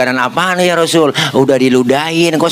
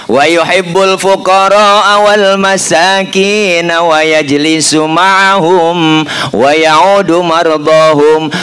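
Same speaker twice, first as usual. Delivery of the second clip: slow, louder chanted reading; Arabic recitation; 3 semitones higher, 3 dB louder, much slower than usual. Acted. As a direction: recite the text with drawn-out reverence.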